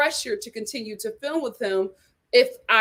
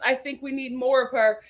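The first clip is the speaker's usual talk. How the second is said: ordinary delivery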